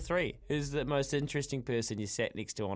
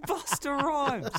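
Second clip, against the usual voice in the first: amazed disbelief; 12 semitones higher, much slower than usual. Real, not acted. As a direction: stretch the name out in laughing awe. Host reacts to celebrity story.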